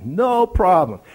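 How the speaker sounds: a Greek guide's breezy "No problem", pushed up and out. Retold or imitated. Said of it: "in his broken English and Greek accent".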